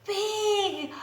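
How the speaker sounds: usual speaking voice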